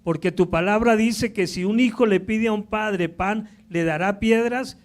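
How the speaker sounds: loud, high, impassioned preaching; preaching at full force. Acted. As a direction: drive the point home with fire. Predica con toda la fuerza. (predicación fervorosa a todo pulmón)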